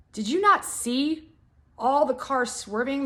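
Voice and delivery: stunned she missed it; incredulous. Retold or imitated. Confronting aggressive driver.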